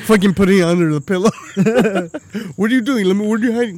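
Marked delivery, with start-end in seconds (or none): 2.6-3.8 raised, louder demanding wife voice; suspicious wife. Retold or imitated. Karen catching him hiding the lube, demanding. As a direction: catch him out, pressing hard.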